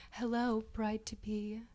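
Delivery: ordinary delivery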